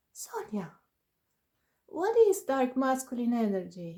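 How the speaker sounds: put-on mum calling out in a strong Italian accent, high and loud. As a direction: imitate an Italian mother's startled question. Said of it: "with a strong Italian accent".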